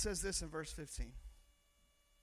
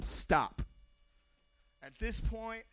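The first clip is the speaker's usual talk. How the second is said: ordinary delivery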